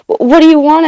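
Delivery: stammering. A narrator's reading. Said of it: "I stammered".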